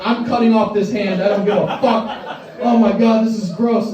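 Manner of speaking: grossed-out yelp, half laughing; mock panic. To himself at work, a grossed-out half-laughing cry. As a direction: squeal like the muck is on your hand. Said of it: "and I'm like".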